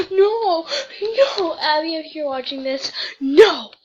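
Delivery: shouted dismay; playful outraged shouting; loud protest. Real, not acted. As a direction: yell no in mock despair. Protest, calling out to Abby.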